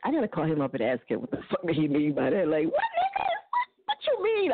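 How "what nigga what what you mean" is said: laughing shout. Real, not acted. Outraged at the ex's remark.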